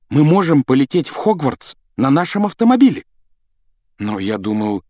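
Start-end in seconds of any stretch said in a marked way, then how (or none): 0.1-3.0 high, fast-rising excitement; excited proposal, high-pitched. A narrator's reading. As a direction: pitch a daring plan with thrilled urgency. Excitedly proposing a daring plan.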